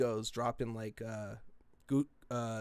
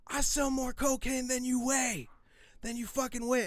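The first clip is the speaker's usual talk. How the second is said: retold yelling; 11 semitones higher than usual. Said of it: "And I was yelling it".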